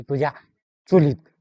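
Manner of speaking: sudden loud bark of a retort; angry shout. Acted. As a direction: spit the last word out in anger. Prank caller rebuffed angrily.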